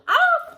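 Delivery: very high laughing shriek. Real, not acted. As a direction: let out a high squeal of laughter.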